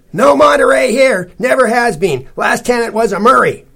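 high, loud, curt householder; brusque stout lady. A narrator's reading. Stout lady turning visitors away, brusque. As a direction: dismiss them at full volume.

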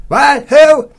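high, very loud squawked questions; brusque stout lady. A narrator's reading. Stout lady to visitors over the TV, brusque. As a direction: fire back loudly, not catching it.

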